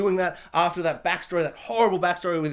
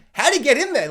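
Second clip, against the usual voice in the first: baffled; 7 semitones higher, 6 dB louder, faster than usual. Retold or imitated. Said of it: "I'm like".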